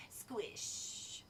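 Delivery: whispered squish. A narrator's reading. Blob creeping close.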